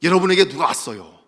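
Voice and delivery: ordinary talk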